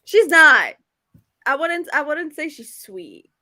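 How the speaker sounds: ordinary talk, nothing marked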